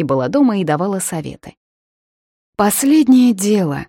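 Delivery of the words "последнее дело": mother's advice. Retold or imitated. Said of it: "говорила она".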